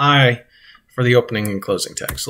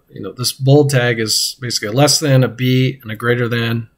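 ordinary talk, nothing marked

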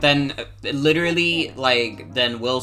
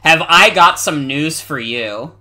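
loud; 2 semitones higher, 9 dB louder than usual. Real, not acted. Catchphrase shouted.

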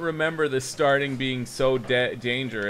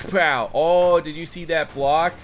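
loud, higher-pitched burst of excitement; excited boast; 6 semitones higher, 6 dB louder than usual. Real, not acted. Gamer showing off a block to viewers, excited. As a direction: shout it, thrilled by the block. Celebrates own block in game.

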